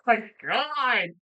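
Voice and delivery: high, loud burst of amazement; loud, astonished outcry; real disbelief. Real, not acted. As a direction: react like you can't believe your friend. Stunned reaction to friend's marathon play.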